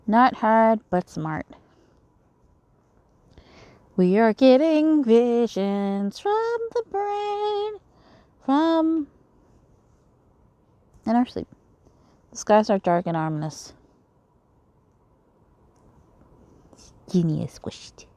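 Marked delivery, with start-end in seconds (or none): 4.0-9.1 high, very slow dreamy voice; high, slow otherworldly drone; eerie dream voice. Acted. Eerie dream vision line. 11.1-18.1 reading a dream sequence in character; lightly voiced sorcerer taunts; game dream scene read in character; voicing a villain scene.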